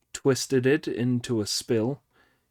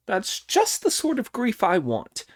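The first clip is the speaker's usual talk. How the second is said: high amused voice, a touch of laughter; laughing Monkey; 11 semitones higher, 3 dB louder than usual. Acted. Monkey pleased with Pig's wailing, high and amused. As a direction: crow with approval. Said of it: "laughed Monkey".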